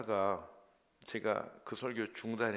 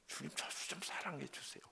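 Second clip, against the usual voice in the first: soft unvoiced whisper of a prayer; hushed pleading prayer whisper; whispered plea; 4 semitones higher, 9 dB quieter, faster than usual. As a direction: breathe the prayer, almost no voice. Retold prayer, suddenly whispered.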